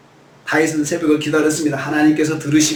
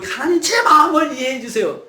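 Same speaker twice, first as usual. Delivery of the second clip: crying out; 8 semitones higher than usual. Acted. Preacher as David crying out at dawn. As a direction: cry out to God the moment you wake.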